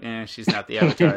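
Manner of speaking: loud, disdainful brush-off. Acted. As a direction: scoff it out with playful disdain. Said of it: "that's my own disdain coming out".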